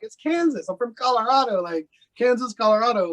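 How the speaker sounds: mildly incredulous contrast. Retold or imitated. Colorado native dismissing Kansas, mildly incredulous. Retold comparing of home states.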